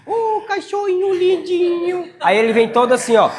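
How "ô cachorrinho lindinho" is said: put-on high, drawn-out baby talk; cooing to a dog. Shown as a demonstration. Showing how intonation calls a dog, cooing high. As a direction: gush over a cute puppy in a high, slow voice. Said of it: "E é a entonação".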